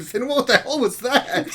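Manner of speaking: ordinary talk, nothing marked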